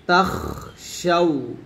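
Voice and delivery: তাখশাউ said slowly with air pushed out on the খ; breathy kha. Shown as a demonstration. A teacher modelling the breathy খ. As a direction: let air escape on the খ in তাখশাউ. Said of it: "খটা কিন্তু বাতাস বের হবে".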